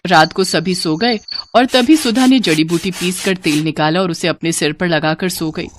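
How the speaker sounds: ordinary delivery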